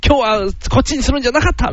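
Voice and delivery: high-pitched comic self-reproach; mock regret. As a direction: act out kicking yourself over a bad choice. Acting out regret over menu choice.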